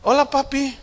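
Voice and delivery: high, sweet little greeting; daughter's voice. Retold or imitated. Daughter greets her dad before a request, high daughter voice.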